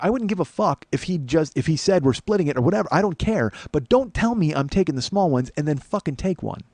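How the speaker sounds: retold angry rant. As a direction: reenact telling them off. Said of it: "getting so fucking mad".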